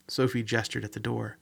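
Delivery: usual speaking voice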